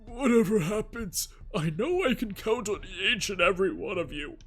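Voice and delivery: put-on slow, high, solemn anime girl. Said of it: "Kuze's tone grew more solemn".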